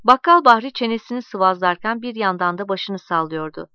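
usual speaking voice

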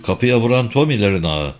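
ordinary delivery

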